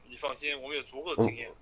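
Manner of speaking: ordinary delivery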